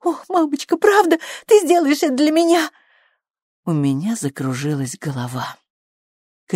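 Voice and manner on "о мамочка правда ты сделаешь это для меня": put-on high, eager girlish questioning; hopeful pleading. A narrator's reading. Daughter clutching her mother's hand, hopeful pleading. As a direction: ask eagerly, voice leaping high. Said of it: "глаза её засверкали".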